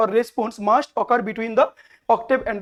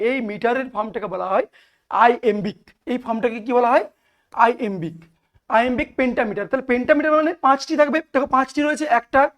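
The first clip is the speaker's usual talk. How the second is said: ordinary talk, nothing marked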